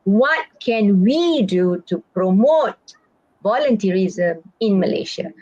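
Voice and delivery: slow repeated exam question, "we" and "promote" lengthened; stress on promote. Shown as a demonstration. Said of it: "I repeat".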